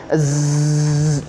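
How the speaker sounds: a long buzzing "Z"; "Z" hummed out, buzzing; electric buzz z. Shown as a demonstration. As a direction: buzz like you got a shock. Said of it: "kayak kena setrum".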